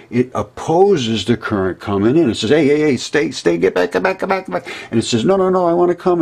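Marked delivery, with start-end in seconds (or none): 2.5-4.7 high, pushy voice shoving the current away; coil field shouting back. Acted. Magnetic field bossing the incoming current, high insistent role voice. 5.2-6.2 pleading current. Acted. Incoming current personified answering.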